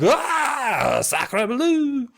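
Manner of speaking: very high comic wail; panicked cry. Acted. Cape man fleeing the rats, panicked comic cry. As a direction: wail it in mock French panic. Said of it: "being like".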